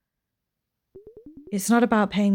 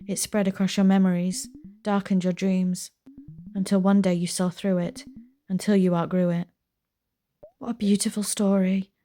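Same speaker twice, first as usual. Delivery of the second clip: ordinary delivery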